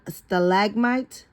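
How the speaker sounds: ordinary delivery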